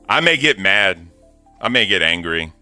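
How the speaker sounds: ordinary delivery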